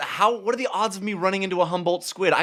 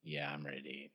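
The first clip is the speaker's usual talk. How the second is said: much lower, soft, mopey creature voice; glum octopus voice; 13 semitones lower, 23 dB quieter than usual. Acted. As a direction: voice a sulky octopus, low and quiet.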